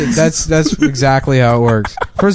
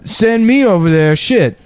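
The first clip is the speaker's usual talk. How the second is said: Steve Harvey impression; 7 semitones higher, slower than usual. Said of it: "he's like".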